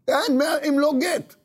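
high, insistent threat; acted ultimatum. Wife demanding action or a divorce, high mock ultimatum. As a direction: insist with pitch way up.

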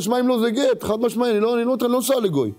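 high, insistent acted threat; firm ultimatum. Wife telling husband it is divorce otherwise, acted high insistence.

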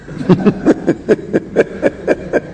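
wordless burst of chuckling; real sustained laugh. Real, not acted. Storyteller cracks up at his own joke, sustained laughter. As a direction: let the laugh run on for a moment.

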